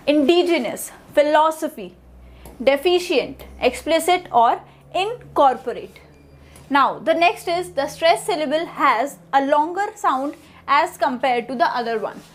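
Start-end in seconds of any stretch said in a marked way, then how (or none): none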